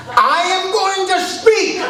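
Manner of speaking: high, slow retold yell. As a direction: scream it from the pew. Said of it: "And I yelled".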